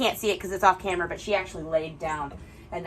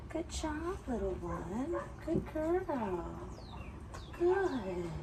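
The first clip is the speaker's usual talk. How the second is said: higher, softer praise for a good dog; 4 semitones higher, 11 dB quieter, much slower than usual. Real, not acted. Trainer softly praising her calm dog, gentle high coo.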